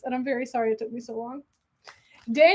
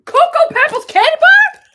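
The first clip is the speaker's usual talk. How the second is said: much higher and louder, squealing with delight; 14 semitones higher, 13 dB louder than usual. Real, not acted. Finding a candy bar in the box, delighted squeal.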